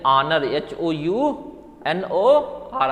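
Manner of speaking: ऑनर said, then एच ओ यू एन ओ आर spelled slowly; spelled out letter by letter. Shown as a demonstration. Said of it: "ऑनर भी". (एच ओ यू एन ओ आर को धीरे-धीरे अक्षर-अक्षर बोलना)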